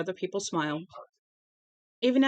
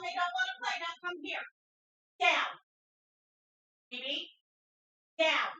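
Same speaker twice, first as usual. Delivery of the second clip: much higher, much softer pet-talk voice; coaxing the dog; 11 semitones higher, 8 dB quieter than usual. Real, not acted. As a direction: coax the dog gently but firmly.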